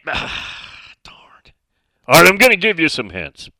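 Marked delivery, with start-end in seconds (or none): 0.0-1.2 sighing whisper. Real, not acted. Frustrated aside while giving hints.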